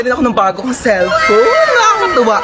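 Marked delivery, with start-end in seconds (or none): 1.7-2.4 squealing, much higher and delighted; excited. Acted. Woman thrilled by her new cellphone, excited squeal. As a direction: shriek with joy over the new phone.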